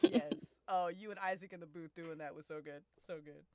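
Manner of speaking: ordinary talk, nothing marked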